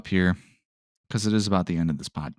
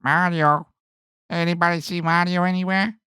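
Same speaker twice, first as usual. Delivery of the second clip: high nervous cartoon voice, searching; timid high call into the dark mansion; Luigi's Mansion Luigi; 10 semitones higher than usual. Podcaster imitates older Luigi lost in the mansion, high timid voice. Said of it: "what's he sound like in Luigi's Mansion?".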